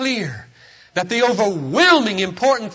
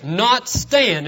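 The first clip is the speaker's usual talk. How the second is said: usual speaking voice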